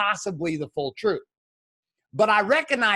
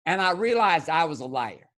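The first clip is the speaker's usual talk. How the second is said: ordinary delivery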